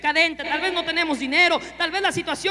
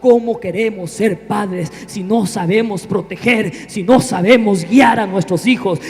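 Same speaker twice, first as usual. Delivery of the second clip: louder preacher's shout; preaching at full force; 3 semitones lower, 9 dB louder than usual. Acted. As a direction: drive the call home to the congregation.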